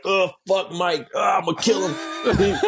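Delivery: hammed-up threat, laughing; mock rage. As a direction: friend imitated, laughing angry shout. Friend voicing his own rage.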